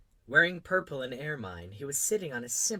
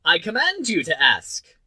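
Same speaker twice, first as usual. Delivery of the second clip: loud, high-pitched royal proclamation; commanding king; 6 semitones higher, 14 dB louder than usual. A narrator's reading. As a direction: boom it out from the throne. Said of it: "the king hastened to say".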